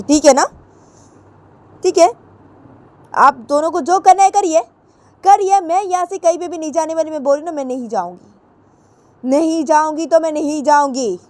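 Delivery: high, loud defiant challenge; daring them to do their worst; defiant yell. Acted. Ritu defies her parents, high loud refusal. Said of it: "तो ऋतु वो बोलती कि".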